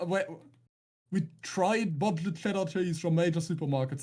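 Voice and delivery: usual speaking voice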